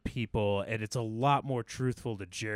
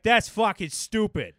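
higher Boston accent, more punch; Boston accent with full lungs; 10 semitones higher, 5 dB louder than usual. Shown as a demonstration. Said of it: "a lot more pertinent in a Boston accent".

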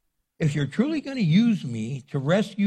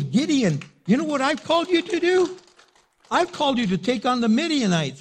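God's voice calling; 8 semitones higher, 3 dB louder than usual. Acted. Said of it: "He goes".

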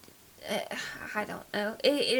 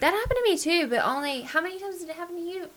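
higher-pitched imitation of an eager one-upper; mock one-upping; 7 semitones higher, faster than usual. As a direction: butt in to outdo the listener. Mimicking a one-upping friend.

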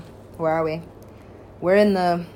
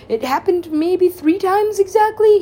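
higher, louder, slower emphatic recall; excited emphasis; 12 semitones higher, 9 dB louder than usual. Real, not acted. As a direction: lean into it with excitement. Excited aside.

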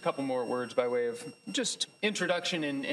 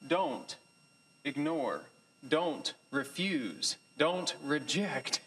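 each "don't" weighed, slow and deliberate; slow pointed warning; slower than usual. Real, not acted. Teacher warning the class not to ignore God, slow and pointed. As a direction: lay down "don't refuse, don't reject" slowly, word by word.

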